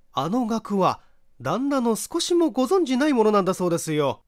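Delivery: high, bright-voiced chatty housewife; gossipy neighbour wife. Neighbour wife eagerly gossips to the visitor, high chatty voice.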